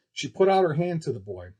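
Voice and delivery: ordinary delivery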